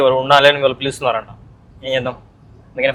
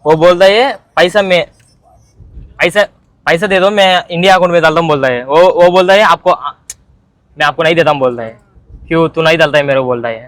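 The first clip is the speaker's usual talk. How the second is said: higher, louder, hammed-up Hindi whine about money; mock Hindi speaker; 4 semitones higher, 4 dB louder than usual. Retelling to a friend how brother dodges paying, mock Hindi rant. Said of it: "ఒక ఆరు పోయి".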